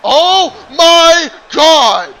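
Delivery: slow, loud, high disbelief; stunned shout. Real, not acted. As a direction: drag out the shock. Stunned by a big move.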